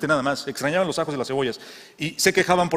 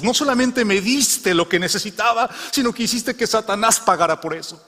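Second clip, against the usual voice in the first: triumphant prayer; 6 semitones higher than usual. Acted. Old woman's triumphant prayer.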